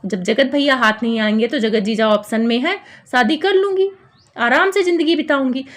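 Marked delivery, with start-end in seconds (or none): none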